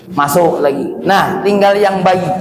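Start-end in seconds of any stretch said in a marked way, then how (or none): none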